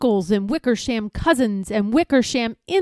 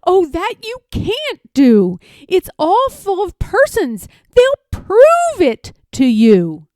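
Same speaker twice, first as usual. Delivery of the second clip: higher, earnest Horton voice, slowing at the end; pleading elephant; 7 semitones higher, 7 dB louder than usual. Acted. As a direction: plead for the tiny people. Horton's character voice.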